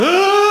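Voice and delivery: long, high, loud scream; nightmare scream. Acted. Man waking his wife from a dream, screaming. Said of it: "Waking his wife up going".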